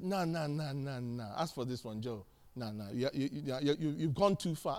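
low, subdued dad voice; quiet, low retold scolding; disapproving father.

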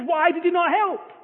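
indignant outburst. Retold or imitated. Man who gave half the house, indignant at Jesus. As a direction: throw the complaint up in disbelief.

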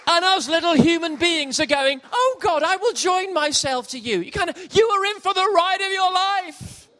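high, loud, soaring preaching; preacher thundering about God's power; preaching at full force. Acted. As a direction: build the sermon to a shout. Sermon climax.

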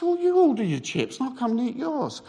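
high, indignant comeback; acted anger. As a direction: argue back in heated mock outrage. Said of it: "I'm getting quite angry".

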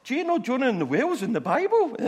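thrilled at a discovery, over the top; gushing amazement. Retold or imitated. Student tells bus stranger about Jonah, hammed-up excitement. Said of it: "sat next to this woman going".

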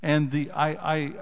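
usual speaking voice

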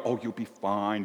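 a hint of a mother calming her child; mom soothing. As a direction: comfort a hurt little kid gently. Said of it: "our moms always go".

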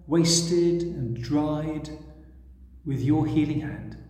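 prayer. Acted. Preacher leading the congregation in a reverent prayer. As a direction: pray reverently for the listeners.

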